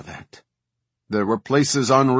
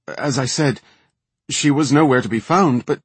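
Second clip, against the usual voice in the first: higher, louder flustered protest; cowed blurting; 5 semitones higher, 6 dB louder than usual. A narrator's reading. Cowed young officer answering the furious commander, blurting it out. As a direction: blurt it out under the commander's fury. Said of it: "the young officer blurted".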